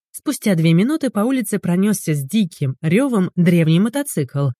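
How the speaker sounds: ordinary delivery, nothing marked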